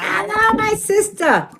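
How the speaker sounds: high, sung-out hello; sing-song greeting. Real, not acted. Greeting her friend in a sing-song.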